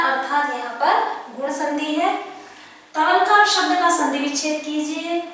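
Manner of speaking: ordinary talk, nothing marked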